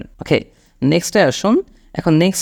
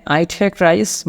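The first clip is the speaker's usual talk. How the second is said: slow model sentence; 3 semitones higher, much slower than usual. Shown as a demonstration. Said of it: "তো এখানে".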